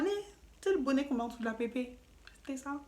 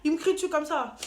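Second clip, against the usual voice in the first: ordinary delivery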